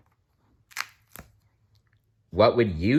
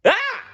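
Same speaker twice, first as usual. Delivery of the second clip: high, loud startled shout; startled yell; 6 dB louder than usual. Acted. The farmer yells as Blinky slams in. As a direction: yell out in shock. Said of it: "yelled the farmer".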